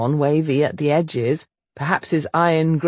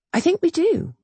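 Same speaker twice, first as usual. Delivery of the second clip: lightly voiced heroine; 13 semitones higher than usual. Heroine quiet reply.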